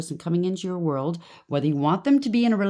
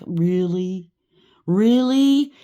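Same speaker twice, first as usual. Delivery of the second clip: disgusted disbelief; 2 semitones higher, 7 dB louder, much slower than usual. Retold or imitated.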